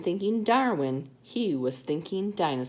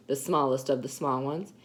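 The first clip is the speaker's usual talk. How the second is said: ordinary delivery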